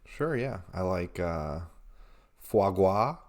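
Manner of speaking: low, halting cool-guy voice; put-on cool voice. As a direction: drop your voice to sound cool. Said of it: "this is just a voice you're doing".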